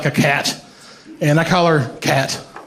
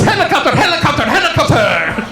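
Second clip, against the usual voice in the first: gleeful dad; 9 semitones higher than usual. Retold or imitated. Dad spinning a severed foot at son, silly high chant. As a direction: imitate a dad goofing around.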